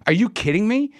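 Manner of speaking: high, appalled question; mock-appalled outburst; incredulous. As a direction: replay shocked disbelief with pitch shooting up. Seeing the hole in his face.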